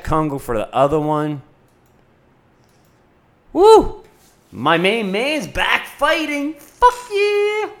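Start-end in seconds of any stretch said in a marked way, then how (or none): 3.5-7.7 high whooping cheer; excited cheer. Real, not acted. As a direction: cheer for your guy. Cheering for favorite fighter.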